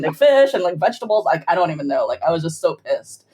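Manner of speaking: ordinary delivery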